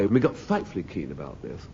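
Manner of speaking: ordinary talk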